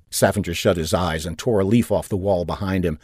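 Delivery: plain reading voice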